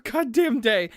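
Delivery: normal talking voice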